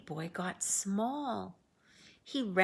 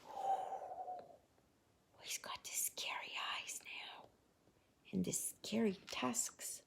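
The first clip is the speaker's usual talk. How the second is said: hushed scary whisper; spooky whisper; 10 dB quieter than usual. Acted. Spooky aside about scary pig.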